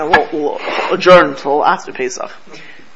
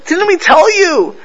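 scolding; 10 semitones higher, 4 dB louder than usual. Retold or imitated. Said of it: "he says".

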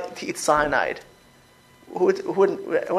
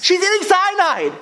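higher and louder, in disbelief; indignant exclaim; 11 semitones higher, 4 dB louder than usual. Real, not acted. Rabbi protesting a student's answer, raised and incredulous. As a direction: throw it back at them, incredulous.

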